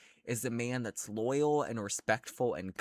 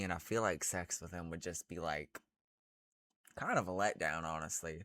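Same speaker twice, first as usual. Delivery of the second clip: deflated; 6 semitones lower, 8 dB quieter, faster than usual. Real, not acted. Disappointed, trailing off.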